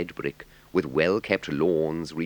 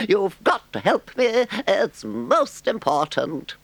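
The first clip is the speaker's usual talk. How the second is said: a much higher, delighted voice; boastful Toad; 5 dB louder than usual. A narrator's reading. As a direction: gush over the visitors. Toad's lines.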